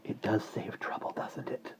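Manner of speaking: soft airy whisper. Acted. Breeze agreeing with the baby in a soft whisper. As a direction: agree in a breath. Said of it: "agreed the breeze".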